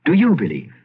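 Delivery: normal talking voice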